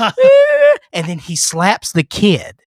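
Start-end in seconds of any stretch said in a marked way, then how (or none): 0.0-0.8 put-on very high loud child wail; mock child cry. The kid wailing as the stepdad mocks him, high loud wail. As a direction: wail like a crying toddler. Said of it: "the kid's like".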